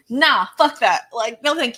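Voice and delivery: loud, punchy flat-out no; emphatic refusal. Real, not acted. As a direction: shut it down loudly, recoiling from the idea. Refusing anything to do with exorcism.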